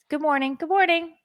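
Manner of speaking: put-on breezy kids rushing out to school; kids' cheerful goodbye. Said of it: "I see the kids".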